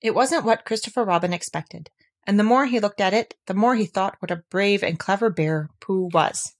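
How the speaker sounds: ordinary delivery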